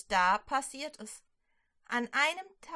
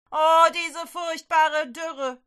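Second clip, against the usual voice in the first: high, loud, slow lament of a groaning king; 6 semitones higher, 10 dB louder, slower than usual. Acted. King Ahab groans about the drought, loud slow lament. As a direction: moan it out like a despairing king. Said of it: "stöhnt der König".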